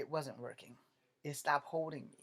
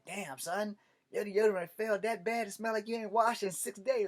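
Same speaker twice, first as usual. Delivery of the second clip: louder, higher ribbing buddy voice; teasing buddy; 6 semitones higher, 4 dB louder than usual. Retold or imitated. Army buddy mocking the failed deodorant, loud teasing. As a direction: rib him like a barracks pal.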